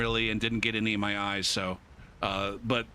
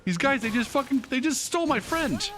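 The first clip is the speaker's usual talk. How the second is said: much higher, frantic pleading; frantic kid; 12 semitones higher than usual. Retold or imitated. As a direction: imitate a panicked kid begging for help. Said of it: "We're like".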